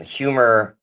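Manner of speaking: ordinary talk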